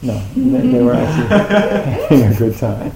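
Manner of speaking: talking through real laughter; breaking up with laughter mid-sentence; laughing. Real, not acted. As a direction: laugh it out while speaking. Laughing at his own joke.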